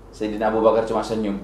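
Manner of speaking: ordinary talk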